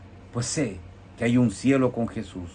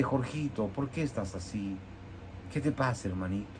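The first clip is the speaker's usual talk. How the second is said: much softer, gently asking a frightened little brother; hushed worry, holding him close; older brother hugging him, asking softly what is wrong; soft tender question; 11 dB quieter than usual. Retold or imitated. Brother hugs dying boy, asks softly.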